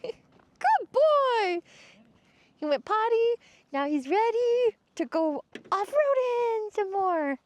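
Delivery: high, sing-song cooing at the pet. Real, not acted. Cooing at her dogs.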